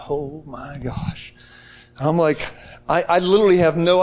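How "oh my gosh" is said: slow, near-breathless, much softer; awed hush. Retold or imitated. Preacher seeing the girl from his vision, slow awed hush. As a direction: barely breathe it out in awe.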